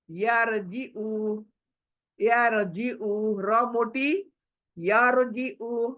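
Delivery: यारजिउ slow, र thick; heavy ra, slow. Shown as a demonstration. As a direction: recite यारजिउ slowly, र full. (यारजिउ धीरे, र मोटी)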